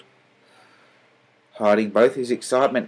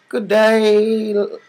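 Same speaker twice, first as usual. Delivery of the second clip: much higher, louder cheerful greeting; cheery; 12 semitones higher, 6 dB louder than usual. A narrator's reading. Kind salesman greeting the family, cheery. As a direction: greet them brightly and merrily. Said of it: "he began cheerily".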